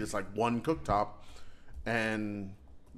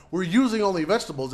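mock server voice; 8 semitones higher, 7 dB louder, faster than usual. Mocking restaurant claim.

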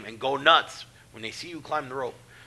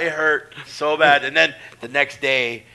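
normal talking voice

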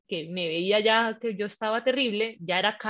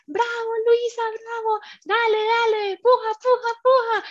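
much higher, louder rallying chant; cheerleading midwife; 13 semitones higher, 5 dB louder than usual. Retold or imitated. Midwife cheers on pushing mother, cheerleader voice. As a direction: mimic a rousing cheerleader. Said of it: "como muy, eh, animadora".

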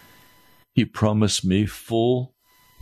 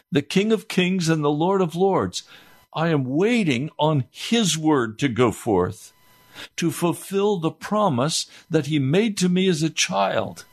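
fervent preaching; 7 semitones higher than usual. Acted. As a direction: proclaim it to the congregation. Preacher raises to full force.

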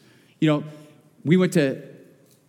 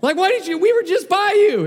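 high, louder exasperated shout; exasperated outburst; 12 semitones higher, 4 dB louder than usual. Retold or imitated. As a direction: let the frustration burst out at the officer. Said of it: "the unspiritual side of your pastor came out".

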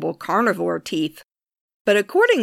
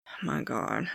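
exasperated mutter; 9 semitones lower, 12 dB quieter than usual. Real, not acted. Blooper, frustrated with own reading.

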